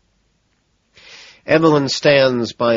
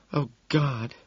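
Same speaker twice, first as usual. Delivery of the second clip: gasping; 7 semitones higher, 6 dB quieter, slower than usual. A narrator's reading. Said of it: "Evelyn gasps".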